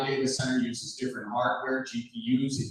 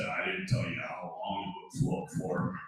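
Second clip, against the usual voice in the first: Uncle Jack; 6 semitones lower, 7 dB quieter than usual. Retold or imitated. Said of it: "He said".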